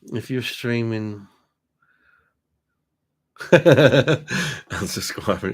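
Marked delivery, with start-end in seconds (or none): 3.4-5.5 real laugh running into slow words. Real, not acted. Reading a viewer's joke aloud, laughing. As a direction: crack up, then get the word out.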